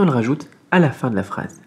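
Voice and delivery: normal talking voice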